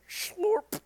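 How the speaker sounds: high squeaky slurp; slurp noise. Pretend. Voicing a comic's sound effect, high slurp. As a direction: make the slurp sound silly.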